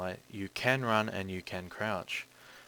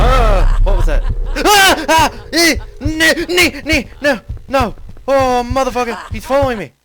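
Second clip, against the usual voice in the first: panicked high screams; screaming in terror; 20 dB louder than usual. Real, not acted. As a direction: shriek in real fright. Jumpscare, chased by killer.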